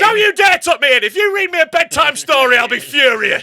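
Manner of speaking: high, very loud mock tantrum; mock furious. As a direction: shout it as an over-the-top threat. Mock furious bedtime threat.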